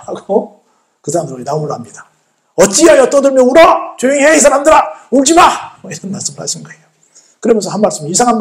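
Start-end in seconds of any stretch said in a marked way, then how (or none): 2.6-5.8 high, loud commanding scold; acted rebuke. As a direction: hush the mourners with a raised voice. Jesus rebukes the wailing mourners. (호통치듯 높고 크게)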